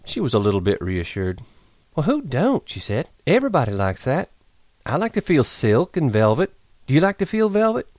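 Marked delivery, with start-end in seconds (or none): 2.0-7.9 put-on high, breezy young woman; Curley's wife voice. Curly's wife voice.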